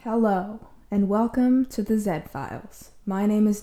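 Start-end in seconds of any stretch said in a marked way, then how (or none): none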